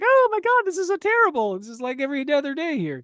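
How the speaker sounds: high, loud squealing mock shock; shocked girls. Girls react to the murder, squealing high. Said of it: "the girls are like".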